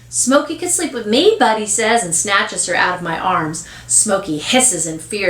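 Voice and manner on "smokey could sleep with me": put-on high, eager small-child voice; little boy. Little boy claiming the cousin's cat, eager. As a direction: pipe up with an offer.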